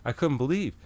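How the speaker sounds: ordinary talk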